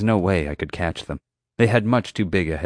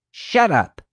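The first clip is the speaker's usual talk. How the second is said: angry; 11 semitones higher, 3 dB louder than usual. A narrator's reading. Angry guard silencing narrator.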